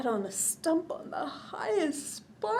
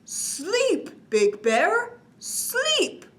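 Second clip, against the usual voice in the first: frowning; 9 semitones higher, 4 dB louder, much slower than usual. A narrator's reading. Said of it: "said with a frown".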